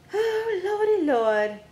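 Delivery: high, slow "Oh, lordy lord"; drawn-out exclamation. Real, not acted. Streamer looking up a gamer tag, exasperated slow exclamation. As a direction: let it stretch out in exasperated surprise. Streamer reacts while searching names.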